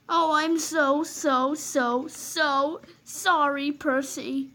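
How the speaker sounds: slow drawn-out mock sorry; sarcastic apology. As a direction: stretch out the fake apology.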